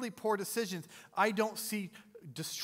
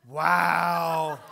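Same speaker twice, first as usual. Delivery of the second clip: loud laughing wow; mock-shocked exclamation; amused wow; 12 dB louder than usual. Real, not acted. Pastor reacts to crowd answer.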